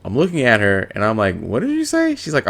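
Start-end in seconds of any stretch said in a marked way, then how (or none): 1.4-2.2 stunned disbelief. Retold or imitated. Stunned at girlfriend's words.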